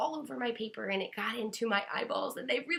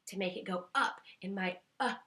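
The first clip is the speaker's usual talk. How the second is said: normal talking voice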